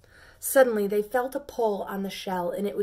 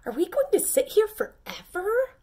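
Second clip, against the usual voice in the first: high, loud, impatient little pea; a high, loud little voice fed up with waiting; 11 semitones higher, 5 dB louder than usual. Acted. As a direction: whine it up high like a restless pea. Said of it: "asked the first pea".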